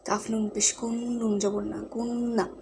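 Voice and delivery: কুন্না with the n hummed through the nose; nasal gunnah. Shown as a demonstration. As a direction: hold the ন in কুন্না as a long nasal hum. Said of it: "গুণনা করে পড়তে হবে".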